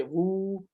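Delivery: "وو" held long and steady; drawn-out vowel. Shown as a demonstration. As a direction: stretch "وو" into a long oo. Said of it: "واو سے آواز کھینچتی ہے".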